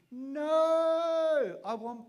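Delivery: high, loud whiny protest; protesting cry. Retold or imitated. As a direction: plead like a child for the pills. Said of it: "And I said".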